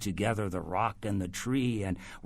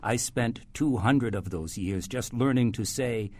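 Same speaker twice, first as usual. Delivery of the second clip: normal talking voice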